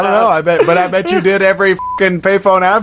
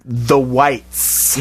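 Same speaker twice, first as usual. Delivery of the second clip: "whites" drawn out on a long hissed s; emphatic delivery; 2 semitones lower, 4 dB quieter than usual. Retold or imitated. Quoting the dude's dirty laundry line, "whites" emphatically drawn out. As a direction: quietly stretch "whites", letting the final s linger. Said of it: "the emphasis".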